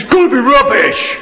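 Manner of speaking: hammed-up sulky child; whiny boy. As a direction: whinge like a stubborn kid. Imitating sulky schoolboy.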